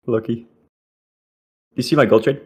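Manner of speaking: ordinary talk, nothing marked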